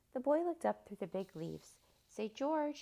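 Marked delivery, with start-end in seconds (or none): 2.1-2.8 high, raised calling voice; loud, high hopeful call upward. A narrator's reading. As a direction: call up to someone far above. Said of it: "he called".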